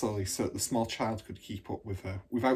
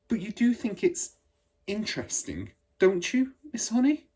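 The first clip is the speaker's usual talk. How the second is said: put-on eager little girl; 11 semitones higher, 3 dB louder than usual. Matilda asking eagerly.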